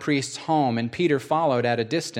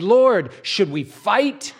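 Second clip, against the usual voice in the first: higher, louder, slow alarmed cry; exclaiming; 7 semitones higher, 6 dB louder, slower than usual. Acted. Disciples asking Jesus whether to fight, alarmed louder cry. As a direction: call out in alarm, slow and loud. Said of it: "they exclaimed".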